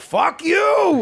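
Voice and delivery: high shouted insult; retold yell. Friend retelling yelling from the car, shouting. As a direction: bellow it out the window. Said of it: "I was just yelling".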